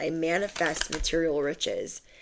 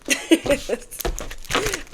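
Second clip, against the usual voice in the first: laugh; 11 semitones higher than usual. Real, not acted. Laugh after a punchline.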